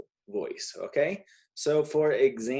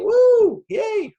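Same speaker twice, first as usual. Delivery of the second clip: playful pretend cheer, much higher and louder; mock cheer; 9 dB louder than usual. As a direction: cheer like someone just got accepted. Teacher acts out a cheer.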